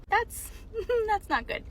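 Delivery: high-pitched, nervous-sounding giggle through the words; laughing. Real, not acted. Patient recalling her second red flag, laughing.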